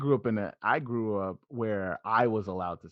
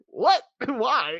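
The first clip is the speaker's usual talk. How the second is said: high, loud baffled outburst with laughter; bewildered; 5 dB louder than usual. Retold or imitated. Answering his buddy Ryan's joke, high laughing bewildered what. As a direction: act caught off guard and laughing. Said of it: "I was just like".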